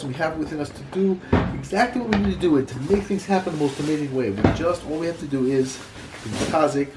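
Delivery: ordinary talk, nothing marked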